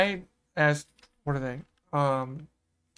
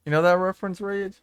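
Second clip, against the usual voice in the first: normal talking voice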